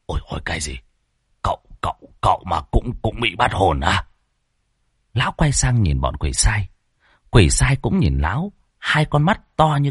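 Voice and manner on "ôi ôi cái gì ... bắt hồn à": soft, stammering disbelief; startled, tripping over the first words; shocked. Acted. Stunned exorcist questioning what he has just heard, shocked. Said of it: "khiếp sợ trợn mắt".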